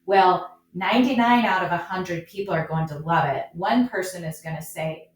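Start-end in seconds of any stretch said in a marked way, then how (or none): none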